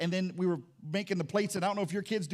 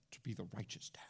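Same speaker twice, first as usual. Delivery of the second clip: hushed, breathy whisper of the phrase; 10 semitones lower, 17 dB quieter than usual. Real, not acted. Pastor confessing his fault to the church, whispered. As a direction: let it drop to a whisper.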